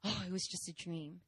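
relieved murmur. Retold or imitated. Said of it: "sweating and thinking".

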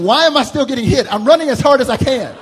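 high, loud, frantic thoughts; panicked kid. Retold or imitated. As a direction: run in circles, confused and desperate. Child panicking while spanked.

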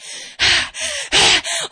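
heavy puffed breaths under a load; weary huff. Acted. Woman hauling jugs to the well, huffing. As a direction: huff as if lugging heavy gear.

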